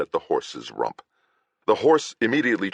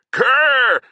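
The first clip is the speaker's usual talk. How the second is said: much higher, louder furious yell; 8 dB louder than usual. Acted. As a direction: bellow the name across the yard. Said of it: "Agravain yelled again".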